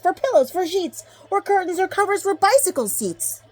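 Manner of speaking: high, loud rapid-fire selling; excited sales pitch. Acted. Onceler pitching the thneed to the Lorax, climbing high and loud. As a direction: oversell it, voice climbing.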